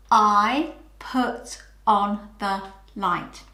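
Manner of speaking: slow, even, word by word; slow choral reading. Shown as a demonstration. Teacher leading children in slow choral repetition. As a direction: say it together slowly. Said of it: "Can you say it with me?".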